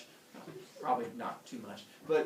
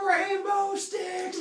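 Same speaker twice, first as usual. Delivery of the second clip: very high, loud, drawn-out squeal; overjoyed humans, slow and shrieking; excited; 7 dB louder, much slower than usual. Acted. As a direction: stretch the words in hammed-up wonder. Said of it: "they get all excited".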